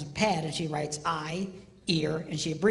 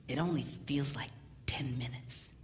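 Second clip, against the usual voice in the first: whispered punchline; 8 semitones lower, 8 dB quieter than usual. Retold or imitated. Whispered aside to son.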